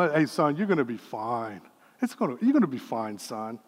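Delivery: put-on low gentle comfort to a hurt kid; calm soothing dad. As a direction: soothe the boy quietly.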